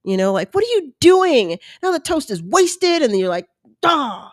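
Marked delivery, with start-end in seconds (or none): 0.5-3.0 high-pitched whiny telling-off; bratty self-scolding. Retold or imitated. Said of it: "I talk to myself like I'm a three-year-old brat". 3.8-4.3 higher, drawn-out "duh"; exasperated self-mockery. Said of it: "I talk to myself like I'm a three-year-old brat".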